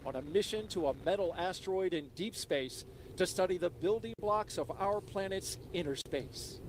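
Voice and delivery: high, thrilled launch announcement; excited liftoff call. Real, not acted. Commentator calls the liftoff.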